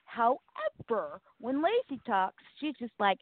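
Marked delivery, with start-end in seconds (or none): none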